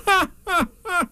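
high, forced ha-ha-ha; careless laugh. A narrator's reading. Rabbit pretending he can't get lost, forced careless laugh. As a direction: separate put-on high laughs. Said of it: "he gave the careless laugh".

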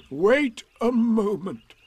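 loud, slow donkey interruption; Eeyore. A narrator's reading. Eeyore stops Piglet to lift a hoof, loud and slow.

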